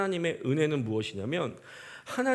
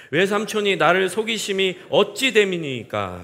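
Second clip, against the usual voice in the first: loud, solemn Bible reading; scripture reading; 3 semitones higher, 7 dB louder than usual. Acted. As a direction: read the verses aloud to the congregation. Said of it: "본문 이십오 절 말씀 함께 읽도록 하겠습니다". (큰 소리로 엄숙하게 성경 낭독)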